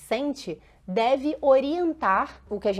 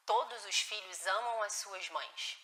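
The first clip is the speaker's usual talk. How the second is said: much softer and slow, "todos" leaned on; gentle reassurance; 3 semitones lower, 12 dB quieter, slower than usual. Shown as a demonstration. Mother calming a child who said he doesn't love her, much softer and slow. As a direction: reassure slowly that every child loves their mother. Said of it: "E é necessário falar".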